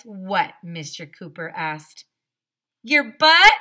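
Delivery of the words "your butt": shouted joke answer. Acted. Kids shout rhyme answers.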